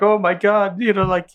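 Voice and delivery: hammed-up drunk sobbing; drunk crying She-Hulk. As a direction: whine it like a tipsy crier. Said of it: "drunk crying".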